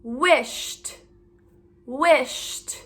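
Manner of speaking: "wished" with ED as a released t; audible t ending. Shown as a demonstration. Teacher modelling the next word, "wished" ending in crisp t. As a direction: say "wished" alone, t after the sh. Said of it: "Do you hear the t?".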